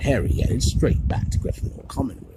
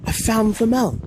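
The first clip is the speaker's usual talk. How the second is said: much higher and louder, thrilled; excited; 10 semitones higher, 5 dB louder than usual. A narrator's reading. Harry to Ron and Hermione, thrilled at finding Flamel. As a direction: burst out with the discovery.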